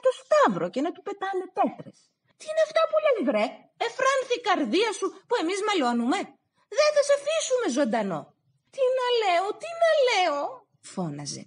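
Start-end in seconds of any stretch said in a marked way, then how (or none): 2.4-8.2 high, fast furious shouting; angry brothers. Acted. Brothers chase him angrily. 8.7-10.7 much higher, pleading cry; desperate plea. Acted. Stavros pleads again.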